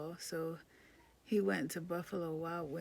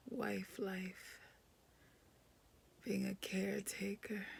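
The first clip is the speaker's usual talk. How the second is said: weary whisper; 3 dB quieter than usual. Real, not acted.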